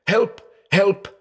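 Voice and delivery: very high, thin plea for help; crying for help. A narrator's reading. Blind man echoing her voice, crying for help. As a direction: imitate her desperate cry, pitched far up. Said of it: "she is crying".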